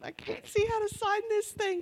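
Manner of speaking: high, choked-up mock crying; tearful. Crying shopper trying to sign at the register, imitated high tearful voice. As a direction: squeak it out as if blinded by tears. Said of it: "and I'm crying".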